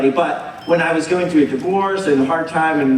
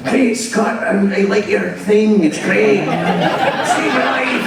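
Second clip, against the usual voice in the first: Scottish accent; 3 semitones higher than usual. Shown as a demonstration. Imitating the tattooed fan from Scotland, thick Scottish accent. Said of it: "Can you do a Scottish accent?".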